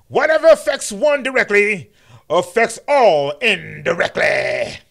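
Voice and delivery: booming mock King sermon; MLK impression. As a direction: deliver it like the pulpit climax. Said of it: "that's M L K talking to y'all".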